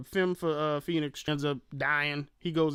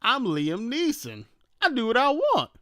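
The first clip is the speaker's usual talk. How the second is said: higher, louder boastful declaration; mock action hero; 8 semitones higher, 4 dB louder than usual. Host voicing Liam Neeson deciding to fight, mock swagger. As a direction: proclaim it with swagger. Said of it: "Then he realized".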